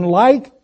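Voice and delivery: "like" lifted high with a rising tune; stress on like. Shown as a demonstration. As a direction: lift "like" up so the word stands out. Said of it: "to emphasize the word like".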